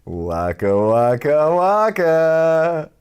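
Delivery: cartoon punchline, rising each time; waka waka. Joke punchline sound.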